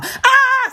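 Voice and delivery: high wailing cry; anguished cry. Real, not acted. Sudden cry in prayer.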